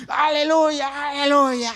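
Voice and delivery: high, loud, drawn-out jubilant cry; shouted hallelujah. Retold or imitated. Companion bursts down the aisle in a sketch, shouting. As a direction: burst in hollering praise. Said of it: "viene gritando".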